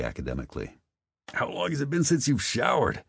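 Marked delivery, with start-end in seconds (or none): 1.3-3.0 teasing. A narrator's reading. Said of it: "Spencer teased".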